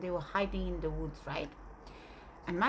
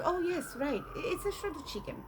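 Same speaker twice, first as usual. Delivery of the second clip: grandmother voice; 8 semitones higher than usual. Retold or imitated. Grandmother insisting, voiced high.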